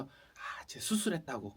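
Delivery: whispered mock claim. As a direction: hiss it like a secret. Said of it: "그러면서". (속삭이며 흉내내는 수군거림)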